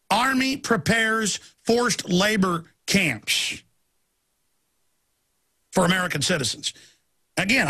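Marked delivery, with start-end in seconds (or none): 0.1-3.6 slow, deliberate headline announcement; headline read. Shown as a demonstration. Host proposing his own headline, slow and weighty. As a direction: spell out each word like a banner. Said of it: "My headline would be".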